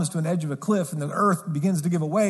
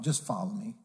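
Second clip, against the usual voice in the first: soft invitation; 7 semitones lower, 10 dB quieter than usual. Retold or imitated. Repeats Jesus's invitation softly.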